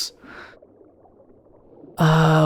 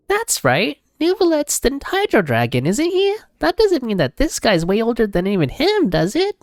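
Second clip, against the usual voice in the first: much higher astonished Paimon; 10 semitones higher than usual. Acted. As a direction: squeal it like a shocked little companion. Voices Paimon's game lines.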